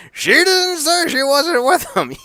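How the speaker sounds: high, slow, smug commentator voice; imitating Don Callis. Host imitating Don Callis on commentary, smug and high. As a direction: sell the twist like a smug announcer. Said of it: "Don Callis goes".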